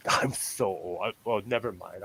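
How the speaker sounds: normal talking voice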